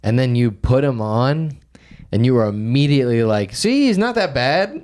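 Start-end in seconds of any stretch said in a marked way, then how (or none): 3.6-4.8 mock insistent. Friend defending the singer.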